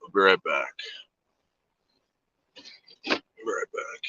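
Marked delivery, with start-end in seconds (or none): 3.1-4.0 whispered aside. Real, not acted. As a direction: breathe it without voice, close to the mic. Repeats line, then whispers it.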